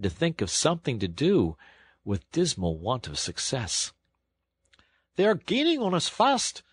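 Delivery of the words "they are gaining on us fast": high, urgent cry; driver crying out. A narrator's reading. Engine driver warns Toad of pursuers, urgent. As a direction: shout the alarm over the engine. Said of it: "cried the engine driver".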